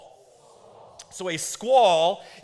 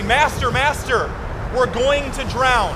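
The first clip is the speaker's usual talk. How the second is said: loud, slow, raised-pitch plea to the master; disciples crying out; 6 semitones higher, 7 dB louder, slower than usual. Retold or imitated. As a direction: call out like frightened disciples. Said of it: "and they say".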